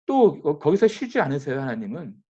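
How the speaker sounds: ordinary talk, nothing marked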